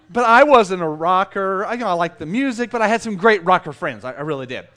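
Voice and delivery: ordinary talk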